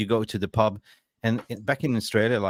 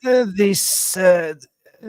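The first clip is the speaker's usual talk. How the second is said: high, straining reporter voice; Simon Stone; 8 semitones higher, 6 dB louder than usual. Acted. Reporter straining on the toilet while asking, strained voice. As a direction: squeeze the question out with halting grunts. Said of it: "sitting on a dunny trying to squeeze".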